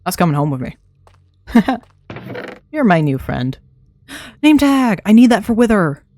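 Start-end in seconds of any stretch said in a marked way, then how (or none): 4.1-6.0 gasp, then high and loud with delight; thrilled to spot the loot; excited gasp. Real, not acted. Gamer spotting a name tag, excited gasp.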